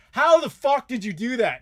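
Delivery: high, loud disbelief; mock astonished yell. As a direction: act the stunned relatives. Family's amazement retold.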